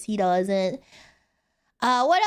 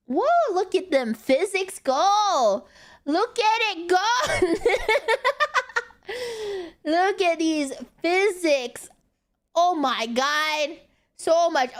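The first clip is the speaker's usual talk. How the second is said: excited awe; 9 semitones higher than usual. Real, not acted. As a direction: gush over the wobble. Marvelling at model physics.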